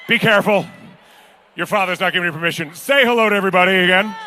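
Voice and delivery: normal talking voice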